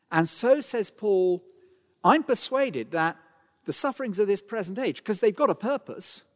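preacher lifting the room; full-force preaching. Acted. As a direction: proclaim it to the whole congregation. Preaching climax of Paul's verse.

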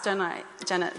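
ordinary talk, nothing marked

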